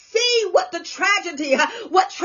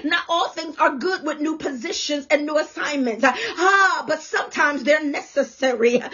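normal talking voice

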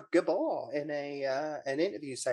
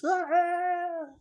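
mock falling scream. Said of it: "Phil goes".

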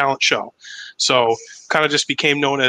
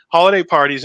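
normal talking voice

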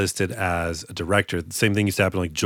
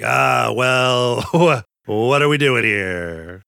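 mock exasperation; 4 dB louder, much slower than usual. Mock exasperated reply to complainer.

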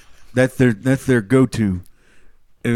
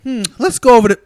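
picky customer; 10 semitones higher, 7 dB louder, faster than usual. Pretend. Picky eater character voice.

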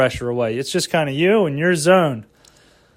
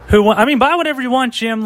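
car salesman voice; 7 semitones higher, 3 dB louder, faster than usual. Pretend. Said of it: "You know, that sort of voice".

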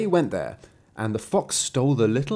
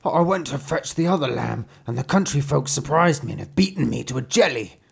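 lightly voiced wolf, aggrieved; a beaten wolf's higher-pitched complaint; mildly wailing wolf telling his woes; complaining wolf; 3 semitones higher than usual.

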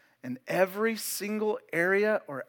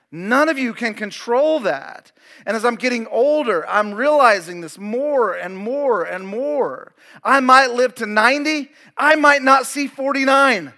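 loud, raised-pitch preacher delivery; full-force preaching; 6 semitones higher, 12 dB louder than usual. Real, not acted. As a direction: preach it hard, driving each point out to the congregation.

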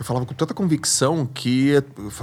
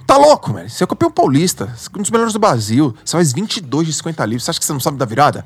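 autobronca aos gritos; 2 semitones higher, 4 dB louder than usual. Retold or imitated. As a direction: yell at yourself to snap out of it. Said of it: "Sabe o que eu falei pra mim? Briga". (bronca em si mesmo, gritando alto)

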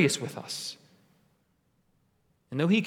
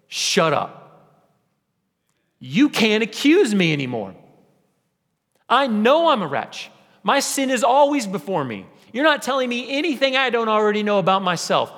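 loud, raised, emphatic declaration; defiant rebuttal; 4 semitones higher, 4 dB louder than usual. Acted. Believer answering the accuser's whisper, loud and defiant. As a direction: stand your ground and answer back forcefully.

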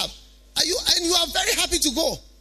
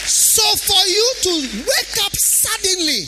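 raised preaching voice; 6 semitones higher, 5 dB louder, slower than usual. Real, not acted. Preacher builds to indignation.

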